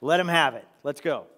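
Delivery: egging on a curse with gusto; king Balak cheering on the curses, loud; eager shout. Retold or imitated. And he's like.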